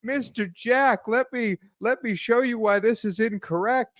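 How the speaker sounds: hammed-up high condescension; mock Phil. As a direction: talk down to him sweetly. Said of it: "Phil comes walking in in his big suit to say".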